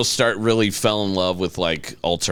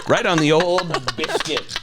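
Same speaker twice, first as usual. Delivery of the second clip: laughing; 9 semitones higher than usual. Real, not acted. Laughing punchline.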